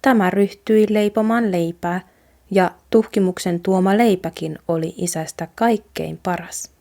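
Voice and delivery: usual speaking voice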